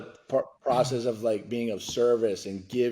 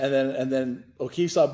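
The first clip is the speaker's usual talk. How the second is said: usual speaking voice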